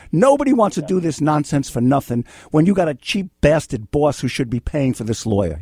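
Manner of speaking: ordinary talk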